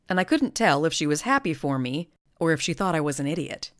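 ordinary delivery

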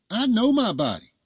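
high, loud, knowing wife declaring it; like Olivia from The Cosby Show. A narrator's reading. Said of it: "Sounding like Olivia from The Cosby Show".

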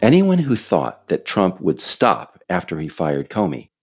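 usual speaking voice

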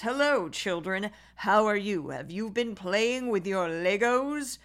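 slow, put-on Vivek voice talking to kids; mock his manufactured voice to the children; Vivek's stagey voice at home with his kids. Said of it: "I don't think that he goes".

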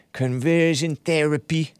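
badly imitated New Zealand accent; butchered Kiwi accent. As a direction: take a clumsy stab at Kiwi vowels. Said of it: "I'm just butchering that Kiwi accent".

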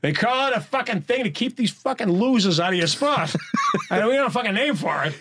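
laughing mock Boston accent. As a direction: rant like a Boston local.

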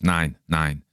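plain reading voice

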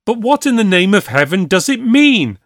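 high, loud panicked outburst; bursting out. A narrator's reading. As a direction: blurt it out in alarm. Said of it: "broke out the lawyer".

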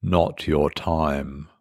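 slow, low, menacing murmur; a stranger's sinister calm, drawn out; the man in the lift. Retold or imitated. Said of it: "the man in the lift saying the words".

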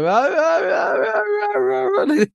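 put-on high babbling baby sounds. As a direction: babble like an infant.

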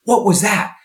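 high, sharp reproach; scolding coach. Retold or imitated. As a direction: call the kid out for the miss. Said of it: "Instead of saying".

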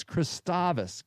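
Christovus corrected to kris-TAH-vus; stress on second syllable. Shown as a demonstration. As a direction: lean on the open middle AH in Christovus. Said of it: "where this accent goes".

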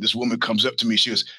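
normal talking voice

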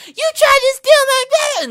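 high, loud shrieking accusation; screaming. Retold or imitated. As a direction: shriek it at him. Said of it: "And started screaming".

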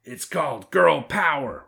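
high, slow, proud declaration; boastful girl boss. A narrator's reading. Girl boss answers the amazed wolf, boastful. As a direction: announce it grandly, drawing it out.

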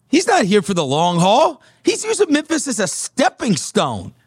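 a hint of a fired-up radio host ranting; retelling the radio host. Radio host JT rants opinion.

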